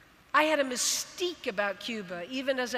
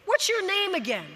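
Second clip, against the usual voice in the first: mock coach yell, higher, calling down to the pool; retold yell; 6 semitones higher, 3 dB louder than usual. Swim coach calling down to the kid in the lane, mild mock yell. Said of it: "And he yelled down".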